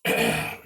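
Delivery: quick throat clearing. Real, not acted. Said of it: "I got heartburn".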